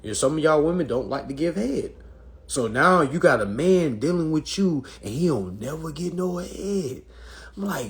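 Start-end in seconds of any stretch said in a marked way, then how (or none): none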